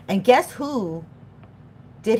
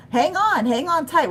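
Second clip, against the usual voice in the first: excited announcement; 9 semitones higher, 4 dB louder than usual. Real, not acted. Announcing a wild ride.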